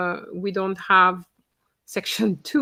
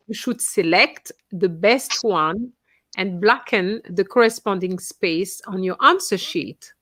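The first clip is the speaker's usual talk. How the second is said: normal talking voice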